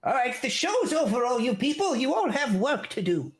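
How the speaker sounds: high, curt gnome voice; irritated gnome elder. Acted. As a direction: brush off the story impatiently. Gnome voice continues.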